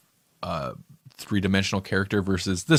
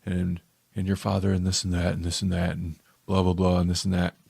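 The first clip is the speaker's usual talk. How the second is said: slightly lowered, understated intense voice; mock intense monologue; 3 semitones lower, 5 dB quieter than usual. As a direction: lightly imitate an intense, low-voiced storyteller. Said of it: "he's more intense and in a lower voice".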